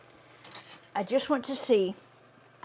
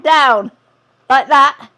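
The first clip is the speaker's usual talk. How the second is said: flustered; 6 semitones higher, 15 dB louder, slower than usual. Real, not acted. Struggling with the hot potato.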